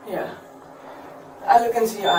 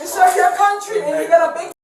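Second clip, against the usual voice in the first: shout; 6 semitones higher than usual. Real, not acted. Shouting at officers.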